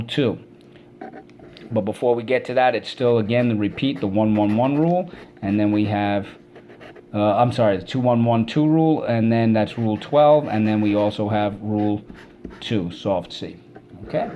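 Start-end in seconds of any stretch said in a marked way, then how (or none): none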